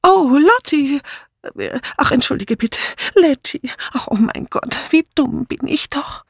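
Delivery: high, flustered, mortified; blurted apology. A narrator's reading. Dora Banner catching her slip, high mortified outburst. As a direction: gasp it out in embarrassment. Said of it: "Dann stieß sie hervor".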